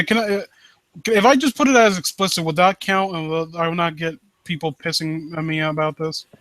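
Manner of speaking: ordinary talk, nothing marked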